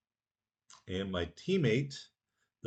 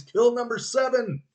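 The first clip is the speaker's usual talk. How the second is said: much higher, louder victory call; triumphant cheer; 11 semitones higher, 7 dB louder than usual. Real, not acted. Gamer celebrating his seventh kill, triumphant shout.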